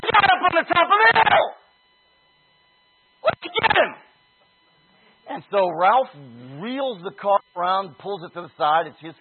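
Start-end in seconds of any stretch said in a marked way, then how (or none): 0.0-3.9 high, loud eager yell; excited shout. Retold or imitated. Friend in the car yelling to chase the man on the hill, excited shout. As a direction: shout it like spotting the target. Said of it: "And one of them says".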